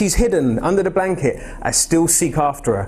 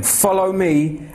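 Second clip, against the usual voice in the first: slow solemn call; 5 semitones higher, much slower than usual. Shown as a demonstration. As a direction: FOLLOW drawn out slow and solemn. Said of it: "when he says, "Follow me".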